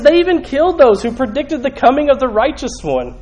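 high, forceful preacher's indictment; fired-up scripture quotation; impassioned accusation. Acted. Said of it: "He goes on, he says".

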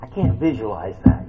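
ordinary talk, nothing marked

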